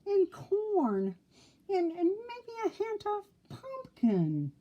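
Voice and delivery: high, wistful pig voice, dreaming of food; dreamy sighing pig. Acted. Oinky dreaming of autumn food, high and wistful. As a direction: pig savoring smells in a high voice. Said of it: "he sighed".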